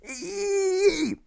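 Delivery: piercing high squeal; stamping down and squealing; shrill squeal. Acted. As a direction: let out a wild squeal. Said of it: "starts to squeal".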